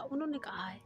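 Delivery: whispered aside, breathless and hushed; secret whisper. Acted. (धीमी फुसफुसाहट)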